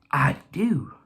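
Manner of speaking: hushed, breathy whisper. Retold or imitated. Racer whispering his reply to his own brain. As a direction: answer yourself in a secret whisper.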